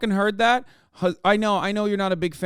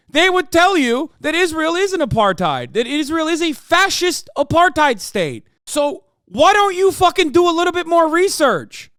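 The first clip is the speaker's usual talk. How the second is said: higher, louder heated yell; angry yelling; 9 semitones higher, 6 dB louder than usual. Real, not acted. Streamer scolding a chatter to do more research, heated yelling. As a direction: tear into the chatter, voice rising.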